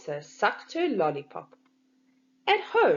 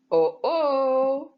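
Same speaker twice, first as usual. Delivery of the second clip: higher, louder alarmed cry; 5 semitones higher, 6 dB louder than usual. A narrator's reading. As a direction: sound the alarm as the list flies off. Narrator marks a sudden mishap.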